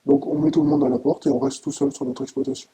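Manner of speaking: emotional outburst, rising in anger; upset and angry. Real, not acted. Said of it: "Vous avez l'air très ému, mais aussi très en colère".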